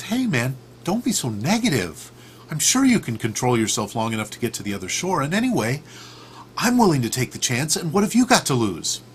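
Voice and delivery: high, insistent pep talk; coaxing frog. Acted. Frog persuading the reluctant scorpion to climb on, coaxingly.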